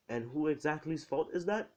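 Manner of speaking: high, lilting accusatory question; mock-innocent, singsong jab; passive-aggressive. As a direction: ask it pointedly, pitch lifted and rising at the end. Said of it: "passive-aggressive, chill manner".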